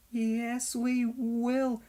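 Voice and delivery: shaken trucks giving in; quivering uncertainly. Acted. Scared trucks answering Donald, timid and quivering. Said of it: "they quivered uncertainly".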